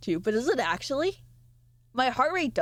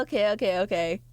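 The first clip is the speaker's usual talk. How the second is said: normal talking voice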